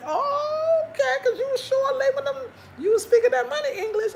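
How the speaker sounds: very high, incredulous squeal; hammed-up high-pitched sarcasm; mock disbelief. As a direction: shoot way up high to call out his act. Mocking driver's feigned lack of English.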